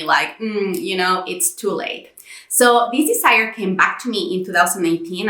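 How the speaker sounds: ordinary delivery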